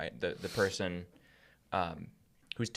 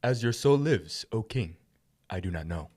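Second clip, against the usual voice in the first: servant's voice. Acted. Voicing Abner answering the king.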